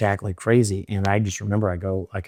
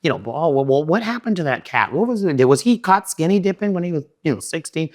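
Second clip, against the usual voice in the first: mock gossip; 7 semitones higher than usual. Mocking gossip about Madison.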